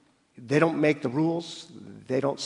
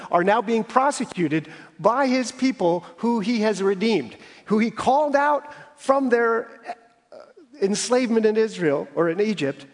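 higher, louder rising sermon climb; impassioned preaching; 6 semitones higher, 4 dB louder than usual. Acted. Preacher rousing the congregation over Israel's betrayal, impassioned. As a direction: build the outrage toward the pulpit peak.

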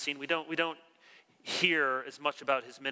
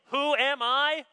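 higher, slow, loud question; rhetorical question; 10 semitones higher, 5 dB louder, slower than usual. Retold or imitated. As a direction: ask it with wonder. John asking humbly.

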